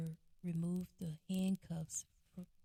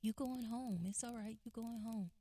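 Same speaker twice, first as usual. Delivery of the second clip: higher, softer, gentle reassurance; reassuring officer; 5 semitones higher, 4 dB quieter than usual. Retold or imitated. Officer calming the brother in handcuffs, soft and reassuring. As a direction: talk him down kindly and softly. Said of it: "He said".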